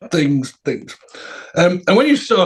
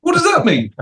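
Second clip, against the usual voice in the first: very high, squawked question; hammed-up incredulous falsetto; mock exasperation; 3 dB louder than usual. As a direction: demand an explanation in squeaky disbelief. Acts a sculptor's baffled protest.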